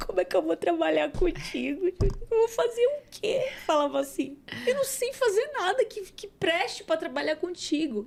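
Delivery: high, crying disbelief; tearful protest. Retold or imitated. She protests to Jesus, high and crying. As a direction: plead through tears that you're not good enough.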